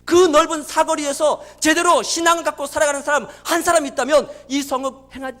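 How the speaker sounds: loud, driving pulpit proclamation; full-force preaching. Acted. Preacher expounding God's word to Jeremiah, full-force preaching. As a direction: drive each phrase hard at the congregation.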